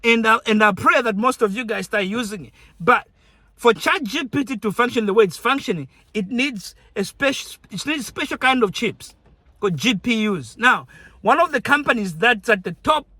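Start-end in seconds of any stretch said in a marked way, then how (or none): none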